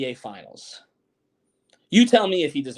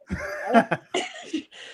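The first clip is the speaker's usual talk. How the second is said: high-pitched laughing fit; real laughter, pitched way up. Real, not acted. Laughing at video clip.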